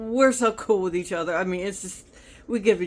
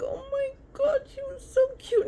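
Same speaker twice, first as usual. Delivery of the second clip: squealing much higher over the little dog; gushing. Real, not acted. Telling viewers about a customer's little dog, high excited gushing. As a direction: melt over how adorable she is.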